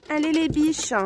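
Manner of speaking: petite voix attendrie pour les poules; voix aiguë affectueuse. Real, not acted. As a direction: parler aux poules comme à des bébés. Calls the hens. (voix de gazouillis affectueux)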